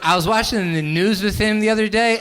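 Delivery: ordinary delivery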